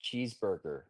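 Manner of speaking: put-on an Australian putting on an American accent; playful fake American accent; mock American accent. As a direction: try to sound American. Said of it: "that's me trying to speak American".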